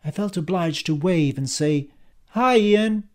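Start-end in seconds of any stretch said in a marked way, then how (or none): 2.3-3.1 high, drawn-out theatrical hello; theatrical greeting. A narrator's reading. Justine greets Ian over the phone, theatrical. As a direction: sing out the greeting theatrically, louder. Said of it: "in a theatrical way".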